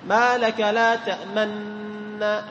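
very slow تأمنا with silent lip rounding after the meem; ishmam demo. Shown as a demonstration. Teacher demonstrating silent ishmam, very slow. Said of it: "لاحظ بدون صوت، إشارة فقط".

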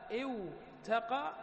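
اوتقى drawn out slowly with a clear و before the ت; slow underlying form. Shown as a demonstration. Teacher showing students the original اوتقى. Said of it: "تقول اوتقى".

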